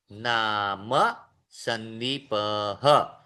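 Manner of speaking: "नाम संदीपः" drawn out slowly, final visarga breathed as "अह"; full Sanskrit vowels. Shown as a demonstration. Teacher showing the class how to say "नाम संदीपः" slowly. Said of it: "ये दोनों ही पूर्ण उच्चारण वाले शब्द हैं".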